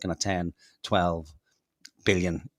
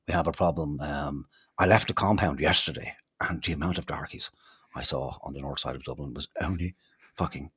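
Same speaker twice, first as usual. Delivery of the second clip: lower, hushed mock posh bigot; mock snob; 5 semitones lower, 4 dB quieter than usual. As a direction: sneer it out as a snob. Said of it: "bringing themselves to go".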